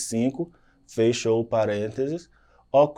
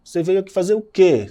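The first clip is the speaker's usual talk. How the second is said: a quick, louder, higher "fazer o quê?"; surprised direct question; 7 semitones higher, 6 dB louder than usual. Shown as a demonstration. Teacher demonstrating an incredulous question. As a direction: demand it in disbelief. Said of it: "São perguntas diretas".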